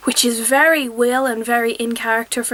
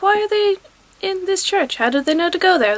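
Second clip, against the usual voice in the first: puzzled viewer; 7 semitones higher than usual. Retold or imitated. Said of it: "and you're like".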